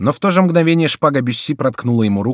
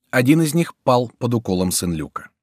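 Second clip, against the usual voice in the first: usual speaking voice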